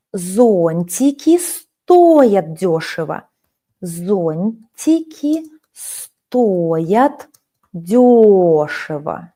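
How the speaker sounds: дёшево slowly with stress on дё, every word drawn out; slow demo, stress дёшево on дё; stress on first syllable. Shown as a demonstration. Teacher shows the adverb stress to her student, very slowly. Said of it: "You see how we change the stress?".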